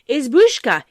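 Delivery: put-on high, loud childish call; children calling out. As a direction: call out to the hut in a shrill child's voice. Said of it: "shrill, childish voices they called out loud".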